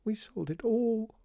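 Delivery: very high, slow wail on the edge of tears; tearful wail. A narrator's reading. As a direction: draw it out, about to cry. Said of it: "and started crying".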